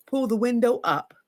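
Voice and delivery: ordinary delivery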